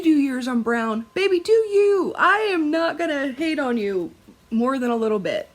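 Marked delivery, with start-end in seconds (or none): 1.1-5.5 playful sass. Acted. Teasing viewers who stitch brown.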